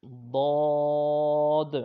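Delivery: ضاد long, thick and loud from the tongue's side; heavy side-tongue daad. Shown as a demonstration. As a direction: draw out ضاد slowly, full. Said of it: "حرف حافیہ". (ضاد زبان کی کروٹ سے موٹا)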